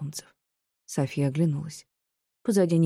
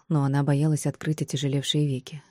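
usual speaking voice

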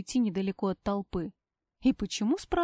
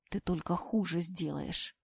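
quiet warning; 4 semitones lower, 7 dB quieter than usual. A narrator's reading. Wounded husband warns quietly.

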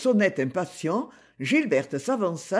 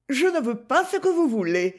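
Gigi's voice; 7 semitones higher than usual. Acted. Gigi voiced in read story.

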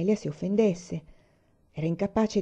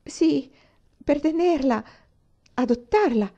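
higher and louder, eager and insistent; growing fervour; 8 semitones higher, 4 dB louder, slower than usual. Acted. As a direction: press on, courage rising. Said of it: "disse una infervorandosi". (con fervore crescente)

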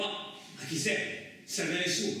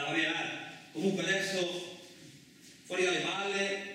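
ordinary delivery

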